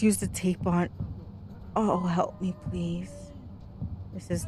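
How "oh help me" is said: ordinary talk, nothing marked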